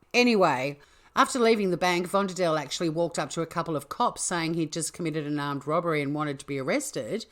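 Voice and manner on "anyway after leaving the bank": ordinary talk, nothing marked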